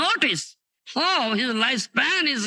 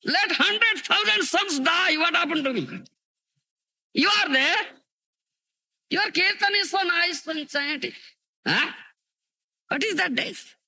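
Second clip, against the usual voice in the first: much higher, impassioned, dismissing the loss; fervent exclamation; 9 semitones higher than usual. Retold or imitated. Srivas brushing off his son's death, high fervent voice. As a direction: cry it out high, as if nothing else matters. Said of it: "Then Srivas said".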